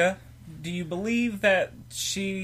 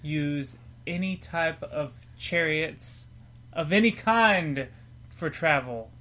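solemn prophecy; 4 dB louder, slower than usual. Pretend. Delivering prophecy dramatically.

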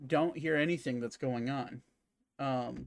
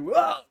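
strangled cry; 9 dB louder than usual. Acted. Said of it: "you just hear him go".

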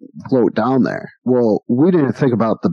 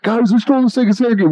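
mock yell; 12 semitones higher, faster than usual. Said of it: "he yelled it".

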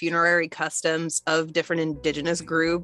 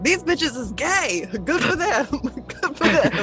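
Samuel L. Jackson; 9 semitones higher than usual. Retold or imitated. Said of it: "You just got Samuel Jackson in your head".

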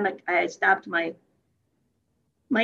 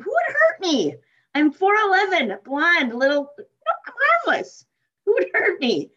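put-on high, wide-eyed little-girl voice, much higher; mock innocent; 9 semitones higher, 5 dB louder than usual. Retorting to her worried friend in a high, mock-innocent voice. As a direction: play tiny and harmless, pitched way up.

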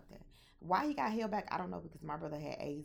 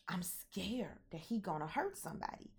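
whispered confession; 3 semitones higher than usual. Real, not acted. Admitting to viewers she feared her brother, whispering. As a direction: let it slip out as a hushed secret.

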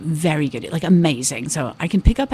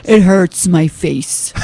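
slow, louder American accent; 5 dB louder, much slower than usual. Shown as a demonstration. Comedian labouring the American accent for host, slow and loud. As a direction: draw out "hurts" with an American R. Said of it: "I could talk like this".

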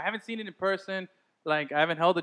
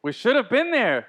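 shouted out loud and slow; scream; 7 semitones higher, 7 dB louder, slower than usual. Acted. Podcast host screaming it out for fun. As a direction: belt it out to the room. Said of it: "I just wanted to scream that out again".

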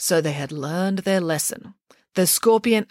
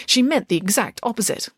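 ordinary delivery, nothing marked

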